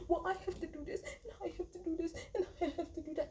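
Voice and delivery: high-pitched mocking imitation; Kyle. Fed-up recapper mimics castmate's constant excuses, high mock complaint. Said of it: "every freaking episode, he can call".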